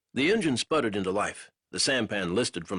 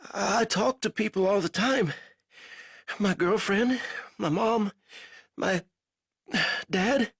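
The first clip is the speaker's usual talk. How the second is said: put-on raised, strained young soldier's voice; a weak captive fumbling for words; lightly voiced delirious prisoner; feverish prisoner; 11 semitones higher than usual. A narrator's reading. Narrator voices Banks.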